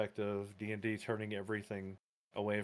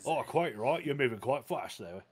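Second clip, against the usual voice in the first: mock posh British; 3 semitones higher, 4 dB louder than usual. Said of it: "Now I wanna hear a British orc".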